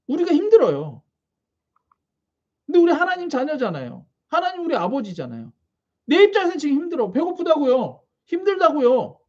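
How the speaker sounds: ordinary delivery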